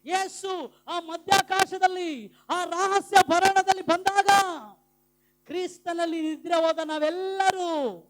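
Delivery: loud, high proclamation building to a long cry; rousing pulpit climax; full-force preaching. Real, not acted. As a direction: preach to the rafters, ending on a held shout. Preaches the rising of believers.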